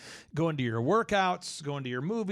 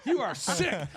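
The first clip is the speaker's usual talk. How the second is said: real laughter, higher and louder; laughing protest; 8 semitones higher, 6 dB louder than usual. Real, not acted. Host laughing at James's dog-kicking joke, louder protest. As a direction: laugh through the denial.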